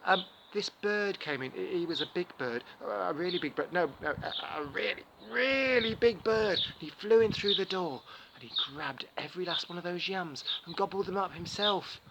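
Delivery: excited tall tale. Acted. Anansi spins the big bird lie, excited and loud.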